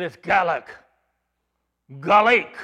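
"Gaelic" said GAL-lick, without the long A; neighbor's Irish pronunciation. Retold or imitated. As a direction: punch "Gaelic" loudly as gal-LEEK. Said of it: "it wouldn't be an A sound".

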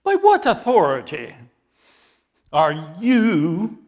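loud, slow contempt for a riffraff rabbi; scornful challenge. Retold or imitated. Priests scornfully challenge Jesus, loud and slow. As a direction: sneer down at the upstart, loud and drawn out. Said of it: "the tone of voice that they would use to Jesus".

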